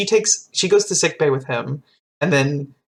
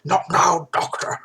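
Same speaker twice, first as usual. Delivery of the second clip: clipped, pressed-back refusal; strained Picard. Retold or imitated. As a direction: push her away with a tight voice. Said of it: "he's like".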